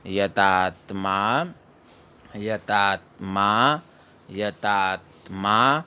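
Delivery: यतात्मा slowly, syllable by syllable; teacher repeats यतात्मा slowly; slow recitation. Shown as a demonstration. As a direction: drill यतात्मा slowly three times. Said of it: "द्वितीय चरण में चलते हैं".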